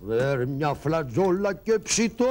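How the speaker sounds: slow deep drawl; slow, low mock singing; low slow joke. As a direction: drop low and drag it. Sings scale badly, joking.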